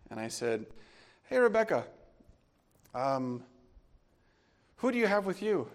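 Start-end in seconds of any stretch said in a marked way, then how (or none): 1.3-5.7 high, louder, forced-steady call; dad phoning his wife, scared but holding it together; strong scared dad. Retold or imitated. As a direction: try to sound strong while scared. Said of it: "like a very strong, scared dad".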